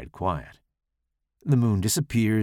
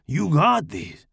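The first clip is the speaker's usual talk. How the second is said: Pablo voice; 8 semitones higher, 3 dB louder, slower than usual. Acted.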